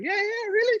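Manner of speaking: high-pitched, innocently pleased reply; smiling away. Retold or imitated. Player answers the marketing lady, smiling away. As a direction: chirp it through a smile, oblivious. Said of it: "smiling away".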